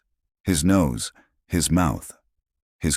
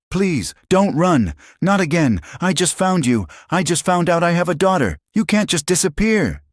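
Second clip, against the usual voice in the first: high, loud begging; desperate pleading; 5 dB louder than usual. A narrator's reading. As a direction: hold her back with everything. Desperate pleading at car door.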